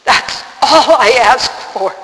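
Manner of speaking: loud, drawn-out desperate plea; retold screamed prayer. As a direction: cry out a last plea to God, slowly. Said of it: "I just screamed out finally".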